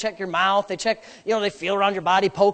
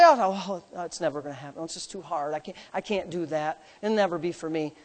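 much softer, low mopey whine; mock defeated; 7 semitones lower, 9 dB quieter than usual. Pastor mimics doubters' hopeless talk, soft and mopey. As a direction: sound hopeless and small. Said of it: "What do people say?".

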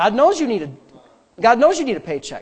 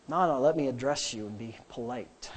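polite correction; 9 semitones lower, 12 dB quieter than usual. Retold or imitated. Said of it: "I just go back and say".

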